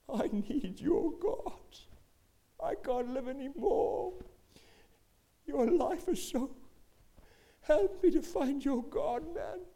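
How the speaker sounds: high, slow, tearful begging; crying plea. Retold or imitated. Filthy coworker begging Keith for God, high slow tearful plea. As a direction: plead through tears, slowly. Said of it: "He starts crying".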